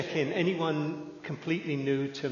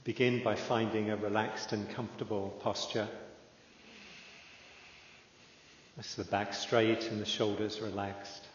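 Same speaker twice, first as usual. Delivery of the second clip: calm, unhurried meditation guide with long pauses; measured, settled voice leaving room to breathe; guided meditation; 3 dB quieter than usual. Real, not acted. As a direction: ease listeners into stillness, phrase by phrase.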